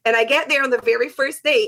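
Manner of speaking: ordinary talk, nothing marked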